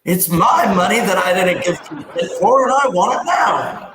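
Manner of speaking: high-pitched demanding ad customer; hammed-up, rising mock outrage; mock ad shout. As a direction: cry it out like an indignant commercial client. Parodies TV ad customer demanding money.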